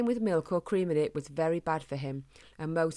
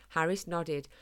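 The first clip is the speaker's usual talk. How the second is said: usual speaking voice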